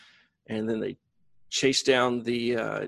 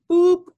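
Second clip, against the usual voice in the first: tractor beam boop; 8 dB louder than usual. Retold or imitated. Tractor beam sound effect.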